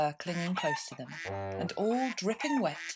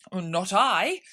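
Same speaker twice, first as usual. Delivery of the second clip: hasty denial; 8 dB louder, slower than usual. A narrator's reading.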